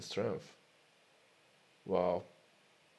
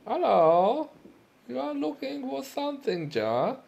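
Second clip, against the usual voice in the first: high, loud German shopkeeper greeting; a hammed-up German merchant calling out; 6 dB louder than usual. Greeting the player in a high hammed-up German accent.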